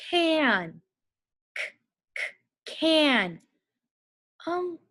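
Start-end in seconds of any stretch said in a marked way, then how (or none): 1.5-3.4 stretched first sound, then can; K, k, can: hard K sound repeated, then can; phonics sound-out. Shown as a demonstration. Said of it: "Let's sound out the word can".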